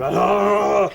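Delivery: short sharp retold yelp; yelp of pain. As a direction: yelp as if hurt. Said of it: "And I was sort of".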